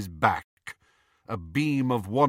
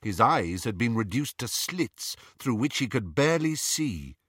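ordinary delivery

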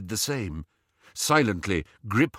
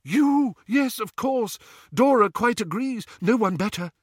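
elated insistence; 12 semitones higher than usual. A narrator's reading. New father assuring chosen godfather, delightedly insistent. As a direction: insist joyfully, bursting out at first.

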